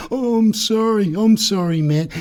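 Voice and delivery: high-pitched placating apology; mock apology. Genius backs down after yelling at clown, mock apology. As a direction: start high and ease down as you apologise.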